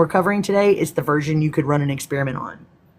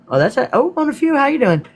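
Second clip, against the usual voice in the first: excited greeting; 11 semitones higher, 3 dB louder than usual. Real, not acted. Excited greeting of new viewer.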